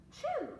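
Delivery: sharp gasp, then high, loud "choo"; mimed sneeze. A narrator's reading. Storyteller as the cow with a cold, mimed high sneeze. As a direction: act out a big storybook sneeze. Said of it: "and the sneeze".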